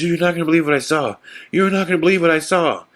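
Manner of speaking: high, agitated retold disbelief; imitated freaking out. Frankie back in the car reporting what he saw, freaking out. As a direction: blurt it high, insisting on being believed. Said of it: "freaking out".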